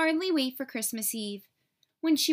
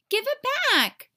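put-on much higher, louder girl's plea; upset child yelling; desperate cry; 12 semitones higher, 7 dB louder than usual. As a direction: shout to get the book back. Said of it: "said Virginia".

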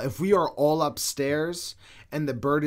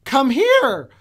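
high, louder clear bird call; parrot voice; 11 semitones higher, 10 dB louder than usual. Retold or imitated. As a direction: mimic the parrot precisely. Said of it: "precise voice going".

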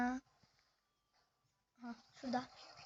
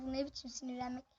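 ordinary talk, nothing marked

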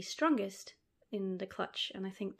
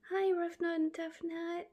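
much higher, tearful pet talk; 9 semitones higher than usual. Real, not acted. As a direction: greet them like babies, moved. Greeting snails, tearful.

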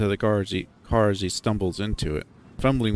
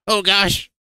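high, loud yelp of alarm; startled, frightened cry; panicked exclamation; 11 semitones higher, 8 dB louder than usual. Acted. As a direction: blurt it out as the ride lurches off. TJ panics as train moves.

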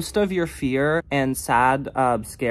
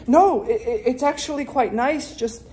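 high, giggly pretend chat; coy small talk; 7 semitones higher than usual. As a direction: giggle shyly through polite chitchat. Pretend chat with imaginary stranger.

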